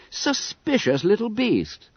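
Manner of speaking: schoolboy voice. A narrator's reading.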